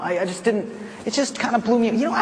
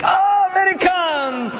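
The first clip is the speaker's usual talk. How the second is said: much higher, louder, tipsy welcome; excited locals; 12 semitones higher, 3 dB louder than usual. Retold or imitated. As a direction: cheer the foreigners into the shack. Excited locals greeting Americans.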